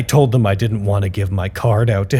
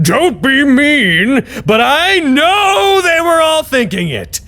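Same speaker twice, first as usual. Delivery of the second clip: high, slow, conspiratorial voice; pitched-up mock paranoia; paranoid scientist; 12 semitones higher, slower than usual. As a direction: play the tall one, darkly suspicious. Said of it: "the tall one said".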